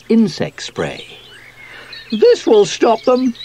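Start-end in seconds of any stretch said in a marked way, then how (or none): 2.1-3.3 high, loud, determined voice; eager squeaky character. A narrator's reading. Sweep aims the spray at the caterpillars, high and eager. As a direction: announce it boldly, pitched right up.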